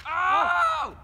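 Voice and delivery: surprised shout. Real, not acted. Surprised yell at a hit.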